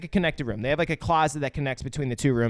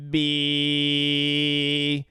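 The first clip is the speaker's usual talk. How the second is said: long, monotone alien letter. Retold or imitated. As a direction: hold the sound like a learning alien. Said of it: "and says".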